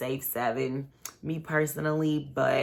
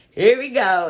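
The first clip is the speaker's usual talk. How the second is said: here we go, louder and higher, fed up; louder exasperated repeat; 6 semitones higher, 9 dB louder than usual. Retold or imitated. Watching the ex-couple sit down, she exclaims "Here we go" louder and higher. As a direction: punch the second "Here we go" up and out. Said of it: "And I was just like".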